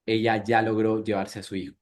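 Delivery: ordinary talk, nothing marked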